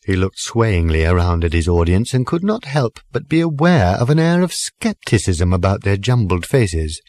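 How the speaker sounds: ordinary delivery, nothing marked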